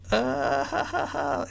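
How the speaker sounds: high, strained ha ha ha; terrified polite chuckle; nervous fake laugh.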